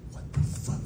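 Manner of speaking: whispered disbelief, barely any voice; hushed, voiceless horror; appalled whisper. Retold or imitated. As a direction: mouth it in a stunned whisper. Mom mouths disbelief on arriving.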